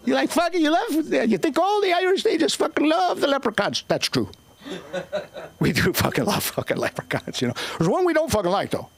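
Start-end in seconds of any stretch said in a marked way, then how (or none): 0.1-3.8 mocking. Mocking audience's view.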